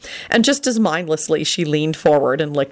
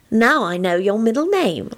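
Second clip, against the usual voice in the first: higher, teasing singsong gloat; sly; 6 semitones higher than usual. A narrator's reading. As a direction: tease with newly gained knowledge. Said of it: "said slyly".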